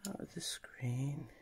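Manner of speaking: soft whisper while concentrating. Real, not acted. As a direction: breathe the words out quietly.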